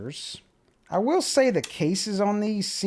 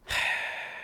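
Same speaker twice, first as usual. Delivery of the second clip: long exasperated exhale; weary sigh; 7 dB quieter than usual. Real, not acted. Reviewer showing a disliked device to viewers, exasperated sigh. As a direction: let out a heavy, voiceless sigh. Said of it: "Yep, the sigh starts".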